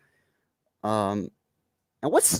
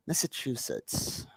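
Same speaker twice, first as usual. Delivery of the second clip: whispered; 11 dB quieter, slower than usual. Shown as a demonstration. Repeats the name under his breath.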